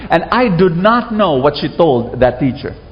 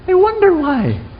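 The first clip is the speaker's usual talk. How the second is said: wondering aloud; 13 semitones higher than usual. Retold or imitated. Asking himself as a child.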